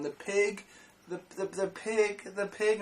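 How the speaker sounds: retold stutter, halting repeats. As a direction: stammer on the pig. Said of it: "some kid was stuttering".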